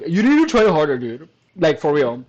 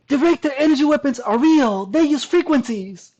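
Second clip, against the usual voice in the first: mock conspiracy voice; 8 semitones higher than usual. Mocking the commenter's claim.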